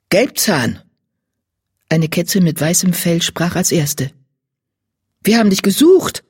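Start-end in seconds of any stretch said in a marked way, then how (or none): 0.1-0.8 a short falling call by name. A narrator's reading. 5.2-6.2 higher, pressing tone; urgent address. A narrator's reading. Cat confronts the one she sought.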